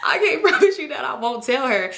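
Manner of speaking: words broken by a laugh; giggling retold promise; laughing through it. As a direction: laugh while quoting her. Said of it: "So she like".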